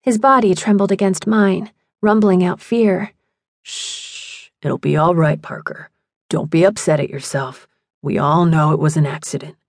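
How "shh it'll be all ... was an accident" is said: low, hushed reassurance; soothing. A narrator's reading. Phil comforting sobbing Parker, low and soothing.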